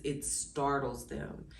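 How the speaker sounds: usual speaking voice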